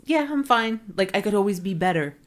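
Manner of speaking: high, breezy mock brush-off; brushed-off fine. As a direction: toss it off like it's nothing. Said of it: "we are quick to just".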